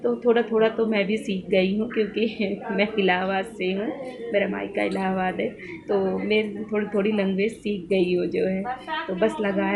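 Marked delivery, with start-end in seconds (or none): none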